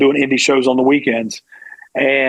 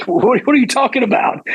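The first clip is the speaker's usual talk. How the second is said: high, baffled retold protest; can't believe what he's hearing; disbelieving; 9 semitones higher than usual. Stunned by a job offer.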